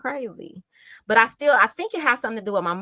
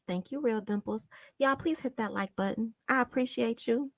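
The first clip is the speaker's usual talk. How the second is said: normal talking voice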